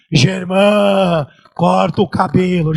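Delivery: low, loud, drawn-out thunder; booming voice of God. Acted. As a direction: boom it slowly like God from the sky. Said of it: "voz de trovão, voz de muitas águas".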